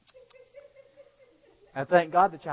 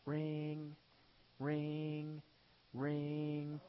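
imitated phone ring; 9 dB quieter, much slower than usual. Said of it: "I called nine one one".

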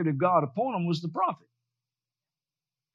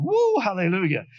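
imitated excitement. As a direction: whoop like an excited churchgoer. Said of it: "we get all excited".

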